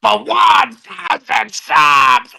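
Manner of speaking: slow shouted possessed growl; Exorcist demon voice. Acted. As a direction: bellow it like a demon. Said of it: "in the Ashton voice".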